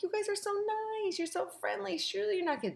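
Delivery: high, gushing mock-naive voice; mock sweet gushing. Mocking her own naive self to Tyler and Corey, high and gushing. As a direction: gush sweetly, far up high. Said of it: "And I'm like".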